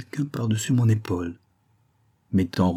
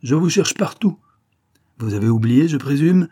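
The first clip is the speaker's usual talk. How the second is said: raised pitch, louder, pointed reproach; acted scolding; 7 semitones higher, 5 dB louder than usual. Miss Wilpert reproaching her forgetful companion, scolding. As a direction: scold a companion who forgot the plan.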